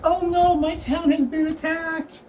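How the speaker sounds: high, drawn-out mock little-kid plea; little kid pleading. Little kid begs adventurers for help, mock pleading. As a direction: put on a slow, wailing child's voice. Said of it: "A little kid comes cu-, running like".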